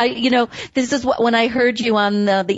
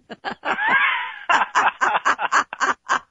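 long high burst of real laughter; laughing; 3 dB quieter than usual. Real, not acted. Host after mimicking her husband, long high laugh. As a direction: laugh freely at your own impression.

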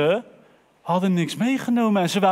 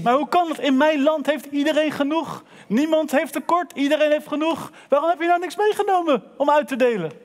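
astonished king; 6 semitones higher than usual. Acted. King baffled, questioning poor man.

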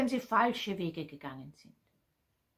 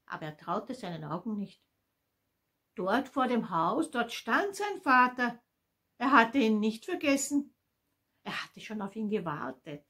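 plain reading voice

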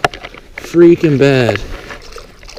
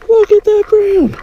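much higher, thrilled exclamation; high excited cry; 3 dB louder than usual. Real, not acted. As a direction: burst out in delight at the catch. Angler shouting at big fish.

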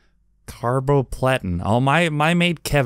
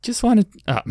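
high-pitched put-on mother voice; annoyingly high mum impersonation; mom voice; 10 semitones higher than usual. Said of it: "I'm not gonna do that voice".

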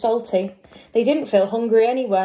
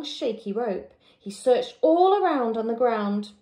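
ordinary delivery

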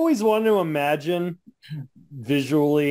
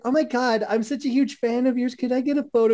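higher, gushing fangirl voice; gushing fan; 6 semitones higher than usual. Retold or imitated. Lady meeting Orange Cassidy, gushing fan. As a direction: squeal it like a starstruck fan asking for a photo. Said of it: "this lady said".

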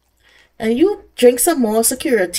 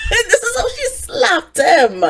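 laughing; 10 semitones higher, slower than usual. Real, not acted. Laughing at the slap.

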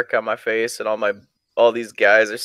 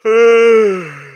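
a big, high, loud groaning sigh out of boredom; loud fed-up sigh; loud exasperated sigh; 10 semitones higher, 8 dB louder than usual. Real, not acted. Bored pause on stream.

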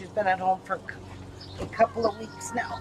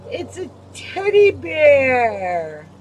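slow, drawn-out, louder show-and-tell voice; 3 semitones higher, 9 dB louder, much slower than usual. Real, not acted. Showing toddler a toy.

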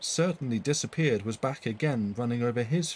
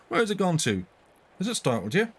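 higher, louder urgent asking; startled questions; 8 semitones higher, 4 dB louder than usual. A narrator's reading. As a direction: look round quickly and ask, concerned. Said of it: "he added to Mister Arley".

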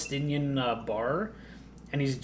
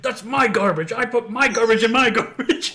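high, loud mock indignation; hammed-up petty anger breaking into a laugh; possessive outrage; 7 semitones higher, 4 dB louder than usual. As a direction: imitate a territorial neighbor arguing. Acted neighbour in garbage quarrel.

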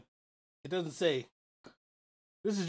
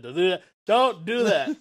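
loud warning; 2 semitones higher, 10 dB louder than usual. Real, not acted. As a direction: shout the warning, building up. Repeated warning building up.

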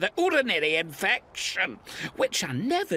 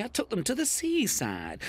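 ordinary delivery

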